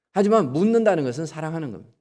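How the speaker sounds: ordinary delivery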